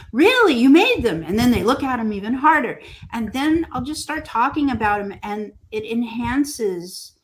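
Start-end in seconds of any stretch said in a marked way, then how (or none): 0.1-1.2 loud, high-pitched astonished reaction; shocked. Retold or imitated. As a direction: imitate a visitor's amazed disbelief. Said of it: "they'll be shocked".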